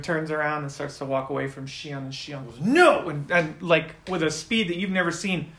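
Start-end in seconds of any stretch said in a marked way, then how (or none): 2.6-3.1 loud, high outburst; acted cry of refusal; sudden shout. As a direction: shout it to stop someone. Shion shouts, then shoves the guy.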